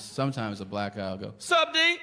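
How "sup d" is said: called-back greeting. Retold or imitated. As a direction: yell it back across the street.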